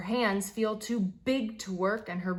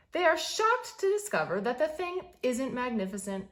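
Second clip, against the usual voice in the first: usual speaking voice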